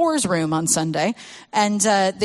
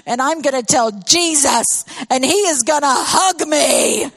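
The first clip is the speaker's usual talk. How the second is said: imitating an offended child; mock angry toddler; 5 semitones higher, 4 dB louder than usual. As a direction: announce it like a stubborn three-year-old. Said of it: "with anger in his eyes".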